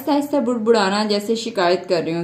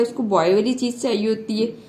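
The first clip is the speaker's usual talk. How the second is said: normal talking voice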